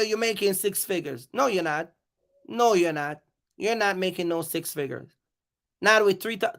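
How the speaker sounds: ordinary talk, nothing marked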